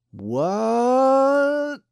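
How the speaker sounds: high drawling deputy; drawled. A narrator's reading. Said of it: "drawled Speedy Pete".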